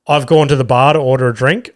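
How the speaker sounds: normal talking voice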